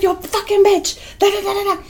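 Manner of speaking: high, light babbled tirade; retold screaming. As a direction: imitate your own screaming tirade. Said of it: "I'm here screaming like".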